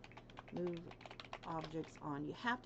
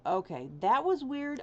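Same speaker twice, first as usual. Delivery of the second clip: surprised, higher and louder; 6 semitones higher, 7 dB louder than usual. Real, not acted. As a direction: react with relieved surprise. Relief after loading.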